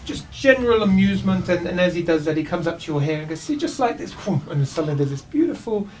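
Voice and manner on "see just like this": showman elf. Acted. Elf performs a trick.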